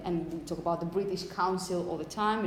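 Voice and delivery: normal talking voice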